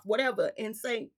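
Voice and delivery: ordinary talk, nothing marked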